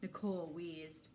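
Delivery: ordinary delivery, nothing marked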